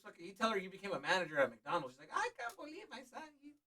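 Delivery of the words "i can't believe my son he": high, soft gushing mock mom; a proud mom over a small win; imitating his mom.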